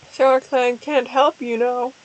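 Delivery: ordinary delivery, nothing marked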